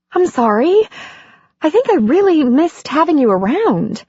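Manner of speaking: lightly voiced embarrassed heroine; Andy's voice. Andy voiced in dialogue.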